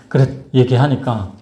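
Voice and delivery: ordinary talk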